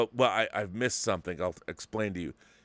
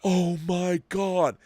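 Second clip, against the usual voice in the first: high, slow, drawn-out gasp of awe; a kid stunned by the twist; slow, high childlike awe; awestruck; 8 semitones higher, 4 dB louder, much slower than usual. Retold or imitated. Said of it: "I was like".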